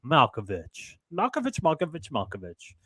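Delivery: a chant of "Malkovich" that sinks to a murmur; a light echo of the movie's chanting voices, fading out; imitating the Malkovich scene. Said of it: "everything's like".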